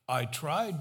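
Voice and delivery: usual speaking voice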